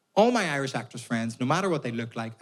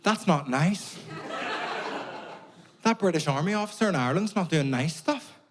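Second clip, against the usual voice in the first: mock dismay in a higher voice; shocked English theatre-goer; 6 semitones higher than usual. Comedian mimics English people in a posh theatre, mock shock. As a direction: play a scandalised audience member, pitched up. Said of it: "all the English people kinda went".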